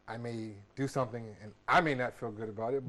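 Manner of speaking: ordinary talk, nothing marked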